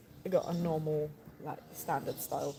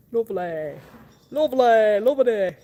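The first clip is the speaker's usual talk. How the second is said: loud ironic "lovely"; louder sarcastic lovely; mock delight; 4 semitones higher, 13 dB louder than usual. Real, not acted. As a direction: exclaim it with wry glee. Delighted at earwax.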